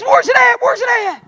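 high, loud frantic questions; urgent, repeated yelp; mimicked panic. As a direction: imitate a daughter panicking over a lost spider. Daughter frantic over fallen spider.

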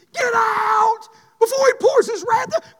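loud, slow cry of warning; preacher's pleading shout. Acted. Quoting God's warning in sermon.

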